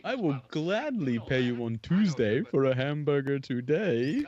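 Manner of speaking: slow, cartoonish hamburger-loving moocher voice; drawn out like the cartoon character begging for a burger; a cartoon voice for a sailor who looks like him; Wimpy impression. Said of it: "he looks a little bit like Blimpy".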